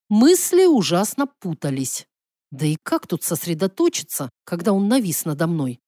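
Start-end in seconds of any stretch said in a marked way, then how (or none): none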